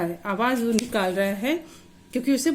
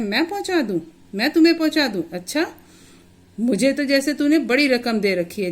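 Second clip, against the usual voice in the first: higher and louder, a twisted sneering taunt; sneering sarcasm; 4 semitones higher, 4 dB louder than usual. Acted. Uncle Sudama sneers at the injured boy, scornful sarcasm. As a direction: twist every word into a mocking jab. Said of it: "बहुत ही मतलब ऐंठ कर उसको बोलता है".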